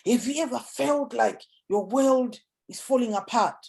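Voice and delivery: lifted, searching question to the crowd; engaging question. Shown as a demonstration. Speaker demonstrating an audience question, engaging. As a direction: draw the audience in. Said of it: "ask a question".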